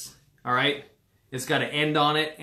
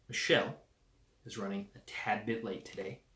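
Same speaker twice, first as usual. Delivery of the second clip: ordinary talk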